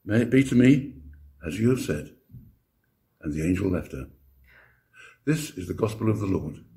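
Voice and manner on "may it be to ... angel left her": ordinary delivery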